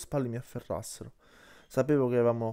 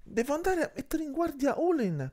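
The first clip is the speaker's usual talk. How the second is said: much higher-pitched, alarmed outburst; urgent exclamation. A narrator's reading. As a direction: cry out that you must go at once. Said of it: "esclamò".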